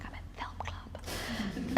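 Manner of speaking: hushed whisper, slow; whispered reply. Retold or imitated. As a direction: whisper so the class won't hear. Whispering back to father in class.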